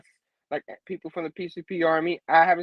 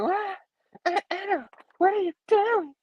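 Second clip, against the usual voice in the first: high, startled cry of disbelief; shocked high yelp. Retold or imitated. Mary Jane shocked as Anna grabs her hair. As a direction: squeak it out in shock. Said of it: "I'm just like".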